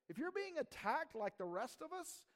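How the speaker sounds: ordinary delivery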